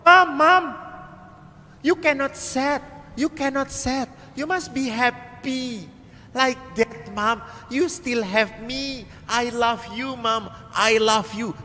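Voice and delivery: put-on high, slow, earnest child comforting mom; high little boy voice, slow and tender; child's voice. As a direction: coax mom like a small boy.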